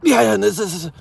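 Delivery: high, loud, jumping in; dying to talk. Acted. Coked-up person desperate for a turn in conversation, high eager interruption. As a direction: butt in like you can't wait for your turn. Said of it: "dying for their turn to talk".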